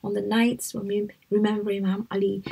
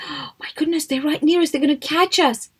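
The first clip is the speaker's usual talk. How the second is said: higher, anxious voice of a frightened companion; scared companion; 6 semitones higher, 3 dB louder than usual. Acted. Companion fearing capture in the cave, anxious and higher. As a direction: voice the companion's panic, pitched up.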